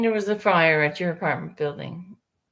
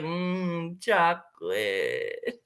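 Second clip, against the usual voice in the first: much lower, slow savoring mmm chocolate; craving; 3 semitones lower, 4 dB quieter, much slower than usual. Retold or imitated. Thinking only of chocolate, slow low craving. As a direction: linger on it like a treat. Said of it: "all I'm thinking is".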